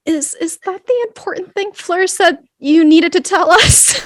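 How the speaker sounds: embarrassed, halting, rising in pitch; flustered embarrassment. Acted. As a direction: ask it mortified and flustered. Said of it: "Rosalie turns beet red".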